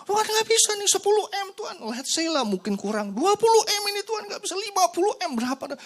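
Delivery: put-on high, loud whining child; childish whining. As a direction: wail at God like a spoiled kid. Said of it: "Teriak-teriak kan".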